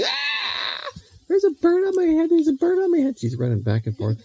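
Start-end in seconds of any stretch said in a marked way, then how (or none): 0.0-3.2 very high mock panic scream; mock screaming girl. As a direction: shriek in play terror, high-pitched. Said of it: "and Mary Lou's screaming".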